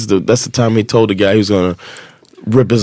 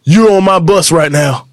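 angry; 6 semitones higher than usual. Retold or imitated. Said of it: "he's like".

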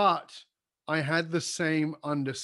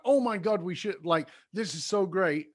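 retold gush, louder and higher; replaying his own delight at a student's success; mock thrilled exclamation; excited; 5 semitones higher, 4 dB louder than usual. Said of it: "And I'd be so excited".

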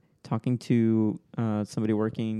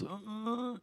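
put-on old man voice; 11 semitones higher, 12 dB quieter than usual. Shown as a demonstration. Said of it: "So this is the old man voice".

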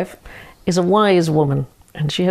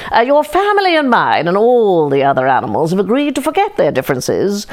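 a crafty fox laying it on thick; sly fox. Acted. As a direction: coax the chicken down like a smooth fox. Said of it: "declared the fox".

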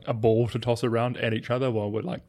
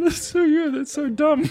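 laughing; 6 dB louder than usual. Real, not acted. Helpless laughter after the joke.

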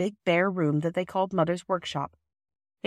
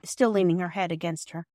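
usual speaking voice

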